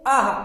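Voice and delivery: "आह" as long aa ending in a light h; visarga pronunciation. Shown as a demonstration. Teacher showing visarga after long aa, pronounced demonstration. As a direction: say "आह" with aa released into a half h. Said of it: "क्या बोलेंगे?".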